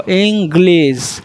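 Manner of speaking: ইংলিশ with a clear g and a sharp s ending, slowly; ইংলিশ said slowly as ing-glis; correct pronunciation. Shown as a demonstration. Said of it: "গয়ে লয় হ্রস্ব ই তালব্য শ".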